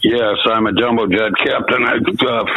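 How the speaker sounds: ordinary talk, nothing marked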